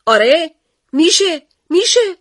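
high, loud insisting yell; shout. Acted. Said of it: "داد زد".

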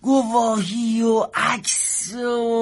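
very slow, drawn-out dismissal; long-drawn scoffing reply; dismissive. Acted. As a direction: stretch each word as you wave the evidence away. Clerk brushes off claimant's evidence.

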